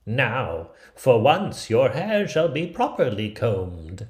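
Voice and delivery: luring her in sweetly; crafty old woman. Acted.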